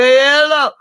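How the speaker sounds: very high, loud warbling greeting; hammed-up drawn-out high greeting; Julia Child. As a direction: hoot it like a tipsy British TV chef. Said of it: "and she'd go".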